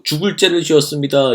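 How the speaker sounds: mock groveling. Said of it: "이렇게 절이라도 하고".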